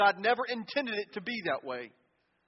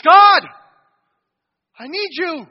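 desperate loud cry, "God" much higher and shouted; 8 semitones higher, 16 dB louder, slower than usual. Shown as a demonstration. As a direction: plead with all your voice, like a drowning man. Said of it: "Cry out to him".

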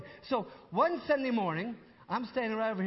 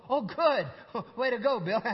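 laughing delight; 4 semitones higher, 8 dB louder, slower than usual. Retold or imitated. Mock delight at news.

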